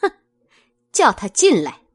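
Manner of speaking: a short scornful hmph, then a firm order; cold sneer. A narrator's reading. Mistress orders the servant in, with a cold sneer. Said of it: "冷笑了一声".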